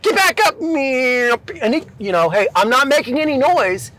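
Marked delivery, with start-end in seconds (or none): 0.0-0.6 high-pitched mock order; mock instructor yell. Instructor ordering sliding trainee up, high mock yell. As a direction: imitate an instructor's sharp command.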